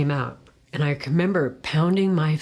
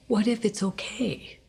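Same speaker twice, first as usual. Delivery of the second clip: high, soft whisper of hope; hushed hope; 6 semitones higher, 4 dB quieter than usual. Retold or imitated. Alone by the graveyard, asking herself in a whisper. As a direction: breathe the question like a secret hope.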